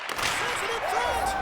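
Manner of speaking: high, drawn-out build-up call; rising excitement. Real, not acted. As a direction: lift the voice as the play builds. Build-up before the goal.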